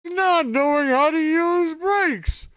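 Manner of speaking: very high, loud, slow Seinfeld squeal; terrible Jerry Seinfeld. Retold or imitated. Streamer hamming a bad Seinfeld bit about his crash, loud and high. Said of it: "That's a terrible Jerry Seinfeld impression".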